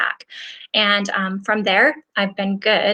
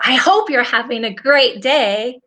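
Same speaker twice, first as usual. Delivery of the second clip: louder, gushing cheerful greeting; enthusiastic; 3 semitones higher, 5 dB louder than usual. Shown as a demonstration. Coach showing how a reader might hear a text, with enthusiasm. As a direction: say it with big, emphatic enthusiasm. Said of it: "I didn't say that with enthusiasm".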